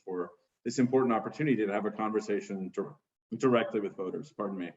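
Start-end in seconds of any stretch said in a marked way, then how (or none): none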